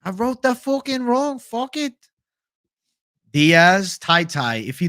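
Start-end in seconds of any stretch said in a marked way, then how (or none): 0.0-1.9 much higher, slowed, a muddled put-on Irish accent; botched Irish accent. Streamer reading chat, trying a failed Irish accent. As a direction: attempt a Conor-style Irish brogue and miss it. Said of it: "I don't know whose accent that is".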